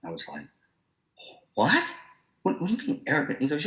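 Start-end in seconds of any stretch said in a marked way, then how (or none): 1.5-3.4 higher, startled "What?"; imitating his own surprised reaction; mock shock. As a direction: play the caught-off-guard listener. Retells shock at friend's remark.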